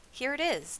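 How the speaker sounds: high, eager call; crying out. A narrator's reading. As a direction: announce it so everyone can hear. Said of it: "Dune cried".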